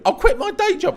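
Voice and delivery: way up high, gushing; high eager squeal. Retold or imitated. Retelling his naive promise to Billy, squealing high. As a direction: blurt it like a starstruck kid. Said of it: "I actually said to him".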